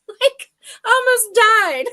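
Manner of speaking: laughing high and breathless. Real, not acted. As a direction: laugh through the words. Laughing while recalling nearly choking.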